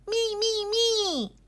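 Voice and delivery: high, loud, insistent me-me-me; eager little girl clamouring; crying out. A narrator's reading. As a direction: clamour for it like a child. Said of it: "cried Greta".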